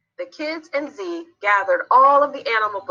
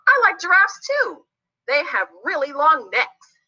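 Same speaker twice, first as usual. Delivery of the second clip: put-on high, childlike, eager voice; pleased small character joining the chat; eager little character; 8 semitones higher than usual. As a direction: chime in keenly in a high little voice.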